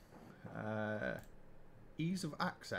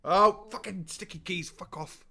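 frustrated swearing; 5 semitones higher, 8 dB louder than usual. Real, not acted. Keyboard glitch ruins the race.

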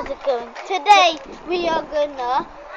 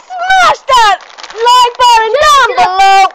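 higher, louder, slow shout; excited shout; 6 semitones higher, 8 dB louder, slower than usual. Real, not acted. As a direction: yell the call to action. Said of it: "Wait, I need to do the outro".

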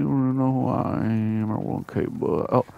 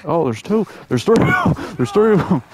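louder, higher burst of surprise; excited alarm; 5 semitones higher, 7 dB louder, faster than usual. Real, not acted. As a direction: call out the count, voice jumping up. Spots more enemies, surprised.

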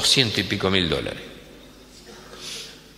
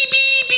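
very high, loud electronic beeps; car beeping; 7 dB louder than usual. Acted. Car alarm beeping at the boss, very high. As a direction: chirp like a car warning alarm. Imitating the car's warning beep.

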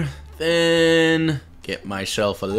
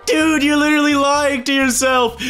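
shouting him down; 9 semitones higher than usual. Real, not acted. Shouting at his friend for lying to himself. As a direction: call him out hard, voice jumping up.

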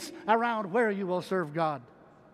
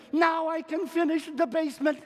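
high, excited plans; eager announcement; 8 semitones higher, 4 dB louder than usual. Retold or imitated. Husband telling his wife his plan, eager. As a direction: blurt out the dream. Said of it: "I said to her".

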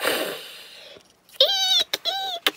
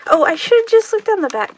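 excited; 8 semitones higher, 8 dB louder, much faster than usual. Real, not acted. Noticing the back.

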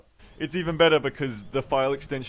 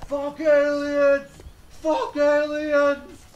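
high, drawn-out scream; long, high-pitched mock battle cry; screaming man; 13 semitones higher, 4 dB louder, much slower than usual. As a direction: scream it like a crazed man in the distance. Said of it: "the man is screaming something".

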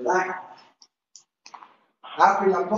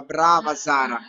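usual speaking voice

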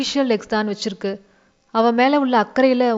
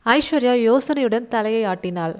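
ordinary delivery, nothing marked

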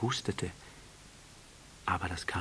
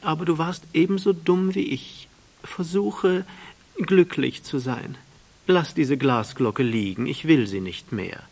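higher and louder proud flower; flower's voice; 9 semitones higher, 7 dB louder than usual. A narrator's reading. The flower sending the prince away, higher and insistent. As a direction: brave and insistent, hiding her hurt.

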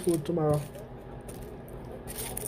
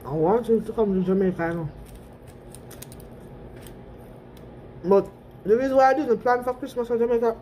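talking between bites; eating while speaking; mouth partly full, chewing. Real, not acted. Seen 1: Holds bitten burger throughout; pursed lips suggest chewing between phrases.

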